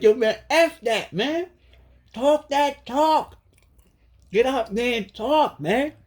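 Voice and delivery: high, slowed voice of the spirits urging him on; spirit beings voice. Retold or imitated. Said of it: "They're like".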